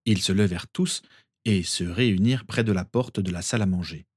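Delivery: ordinary delivery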